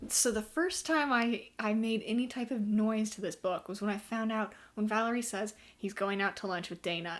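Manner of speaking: ordinary talk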